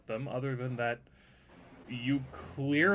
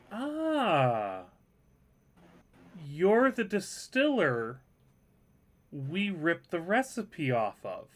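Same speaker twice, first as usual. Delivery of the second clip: slow, higher-pitched character voice; savoring recognition; 4 semitones higher, slower than usual. Acted. Woman sniffing the liquor, slow pleased recognition. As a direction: draw it out as realisation dawns.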